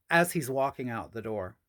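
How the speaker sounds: ordinary talk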